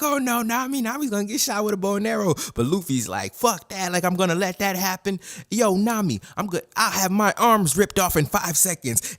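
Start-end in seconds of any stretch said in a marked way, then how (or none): 0.0-2.3 high, rushed fretting; mock panic. Own panicked reaction while retelling. 3.3-9.2 high-pitched, worked-up defiance; defiant bravado. Retold or imitated. Luffy defiantly reassuring Nami.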